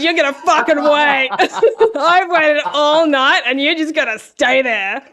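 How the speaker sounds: loud, laughing diva attitude; sassy. Retold or imitated. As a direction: own the room, cheeky queen. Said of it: "and then I was so sassy".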